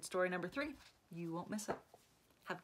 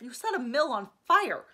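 exasperated outburst; 4 semitones higher, 7 dB louder than usual. Acted. Ian scolding Alex.

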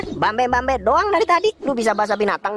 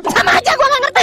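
high character voice; 13 semitones higher, 5 dB louder than usual. Acted. Character voice in a comedy sketch.

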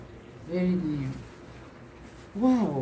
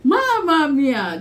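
higher, louder, slow with a laugh; laughing exclamation; 7 semitones higher, 8 dB louder than usual. Real, not acted. Vlogger marvelling at her laptop, laughing exclamation. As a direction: cry it out in delight.

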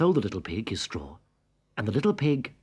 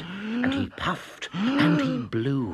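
rising dramatic blowing; 11 semitones higher, slower than usual. A narrator's reading. Narrator acts the wolf blowing.

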